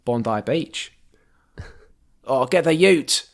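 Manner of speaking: mock Australian, stretched vowels; Australian accent. Shown as a demonstration. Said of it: "for Australian".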